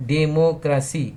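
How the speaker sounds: democracy broken slowly into de-mo-cra-cy; syllable by syllable. Shown as a demonstration. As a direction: say democracy in separate, measured beats. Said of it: "Words with more than two syllable".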